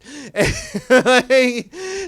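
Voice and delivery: loud, high laugh; real shrieking laugh; high burst of laughter. Real, not acted. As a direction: lose it laughing. Laughs at John's choice.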